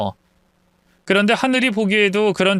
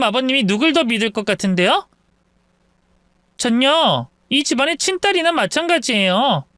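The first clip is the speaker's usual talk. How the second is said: higher-pitched, brazen challenge; scoffing sister-in-law; 8 semitones higher than usual. Acted. Sister-in-law boasting over her elder in-law, scornful. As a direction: throw the threat back with haughty scorn. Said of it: "당황하긴커녕 코웃음을 쳤어요".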